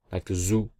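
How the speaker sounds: ordinary talk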